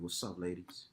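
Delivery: low, soft smooth voice for the ladies; low seductive greeting; sexy voice. Shown as a demonstration. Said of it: "that sexy voice for calls".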